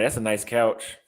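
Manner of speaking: ordinary delivery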